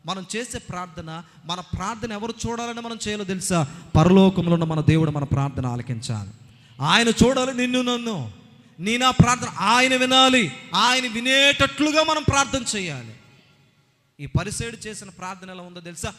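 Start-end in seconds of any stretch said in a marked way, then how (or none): 4.0-13.2 full-force preaching. Acted. Preacher urging the congregation to pray to God, full-force preaching. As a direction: proclaim it to the whole church.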